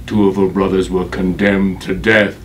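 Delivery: ordinary talk, nothing marked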